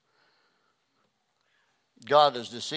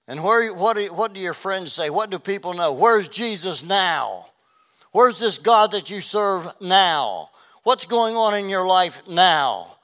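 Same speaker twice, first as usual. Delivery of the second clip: loud, slow, higher taunting questions; jeering questions; 6 semitones higher, 7 dB louder than usual. Retold or imitated. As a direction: taunt him with every question. Mockers' questions.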